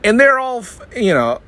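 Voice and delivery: normal talking voice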